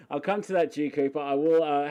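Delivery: ordinary delivery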